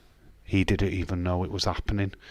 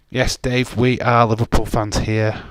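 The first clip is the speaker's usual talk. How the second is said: proud loud declaration; 3 semitones higher, 7 dB louder than usual. Real, not acted. Streamer proudly telling chat they back Liverpool, loud. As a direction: belt it out for the club.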